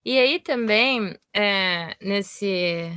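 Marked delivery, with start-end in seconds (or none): 2.0-2.9 trailing off. Real, not acted. Forgets what she meant to say.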